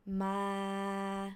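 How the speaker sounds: "ma" held level at normal pitch; flat mid tone. Shown as a demonstration. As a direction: keep "ma" long and straight. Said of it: "so it's flat".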